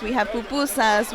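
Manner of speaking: ordinary talk, nothing marked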